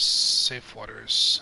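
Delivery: slow, drawn-out relief. Real, not acted. To the crew, back home, slow satisfied drawl. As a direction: savour the words, slowly.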